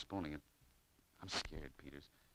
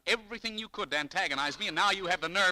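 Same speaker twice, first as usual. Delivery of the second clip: loud, rising furious rant; angry tirade; 12 semitones higher, 12 dB louder than usual. Acted. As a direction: build into a loud furious outburst. Argument with clerk.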